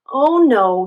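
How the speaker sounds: loud alarmed oh no; panicked turtle in the water; loud, fearful exclamation; worried cry. A narrator's reading. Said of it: "he cried".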